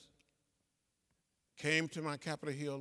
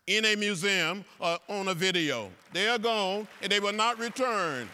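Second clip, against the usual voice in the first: louder, ringing orator's cry; emphatic declaration; 5 semitones higher, 10 dB louder than usual. Real, not acted. Congressman telling students the signs are gone, ringing loudly. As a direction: proclaim it to the hall so it carries.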